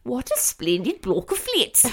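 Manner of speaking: hammed-up upper-class English royal accent; Princess Margaret. Wandering round the house saying it, mock-royal English accent. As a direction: declaim it in mock-royal English, "flats" as "fleets". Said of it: "I just keep wandering around saying".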